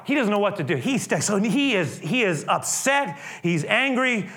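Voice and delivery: normal talking voice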